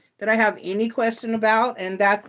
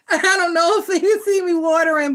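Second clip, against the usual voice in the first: laughing through held-back tears, higher and louder; a wet half-laugh as she admits she's welling up; teary laugh; 8 semitones higher, 5 dB louder than usual. Real, not acted. Reader admitting she's tearing up, laughing.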